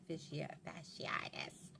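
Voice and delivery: whispered correction. Real, not acted. Corrects the disease name.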